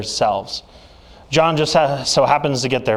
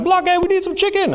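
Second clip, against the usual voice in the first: much higher put-on voice of the skit teacher. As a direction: squeak it out like the substitute from the sketch. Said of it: "the famous substitute teacher skit".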